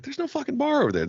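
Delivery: high-pitched incredulous objection; retold protest. Retelling own protest about the bar.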